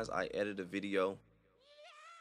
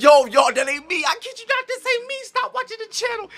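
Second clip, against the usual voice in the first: frantic panic; 16 dB louder than usual. Pretend. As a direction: beg the viewers to stop, panicking. Freaking out at possessed footage.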